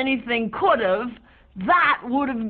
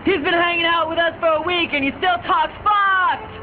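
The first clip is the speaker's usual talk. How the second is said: exasperated shout; 6 semitones higher than usual. Acted. Angry friend complaining.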